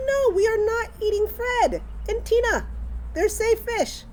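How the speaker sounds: high-pitched, emphatic retold protest; acting out an alarmed, insistent correction; insistent mom. Mother telling daughter the pet fish are safe, insistent.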